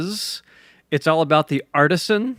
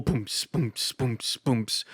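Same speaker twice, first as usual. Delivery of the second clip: vocalized kick-drum thumps; beatbox a soft, steady thump; imitated club beat; 6 dB quieter, much slower than usual. Shown as a demonstration. Composer demonstrates a dance floor loop, imitated club beat.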